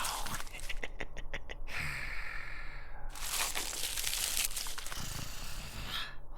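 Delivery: soft, falling sinister laugh; low dark chuckle. Acted. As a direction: let a quiet evil chuckle fade.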